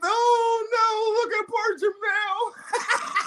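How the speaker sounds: high, very slow fake sympathy; mock pity, sing-song high. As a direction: coo it like fake concern. Mock pity for beaten fighter.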